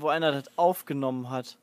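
ordinary talk, nothing marked

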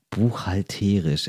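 low, slow buchhal-TÉÉ-risch for Buchhalterisch; shifted stress. Shown as a demonstration. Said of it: "betone ich's auf einer anderen Silbe".

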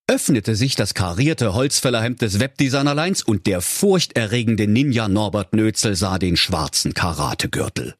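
usual speaking voice

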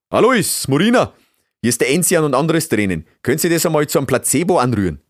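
loud, high call to two helpers. A narrator's reading. Beaver master summons his two assistants, calling out loudly. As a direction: shout orders across the workshop in dialect. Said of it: "und rief".

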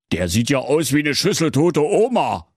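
high, startled king; mock horror. King shocked by the prince's remains, high and startled. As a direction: recoil at the sight. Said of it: "rief der König entsetzt".

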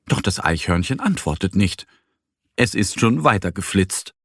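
ordinary delivery

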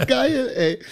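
lachend. Real, not acted. Lacht nach eigenem Witz.

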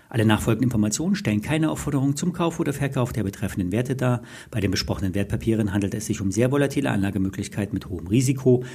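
rattled-off fast disclaimer; fast legal disclaimer. Real, not acted. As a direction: rush through the legal fine print.

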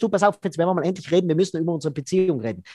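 sehr schnell und drängend; gehetzte Ankündigung. Acted. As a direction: die Aussprache überhastet ankündigen. Partner droht mit Aussprache. (Partner kündigt im Eiltempo das große Gespräch an)

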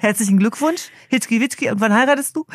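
high-pitched playful mock of a mother; mother's imitation. Mother congratulates prize-winning daughter, then asks about marriage, high mock voice. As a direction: lift the voice high, imitating mother's pointed question. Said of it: "meine Mutter dann fragt".

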